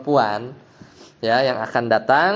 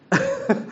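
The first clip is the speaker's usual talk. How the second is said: short laugh. Real, not acted. Laughs after asking who disagrees.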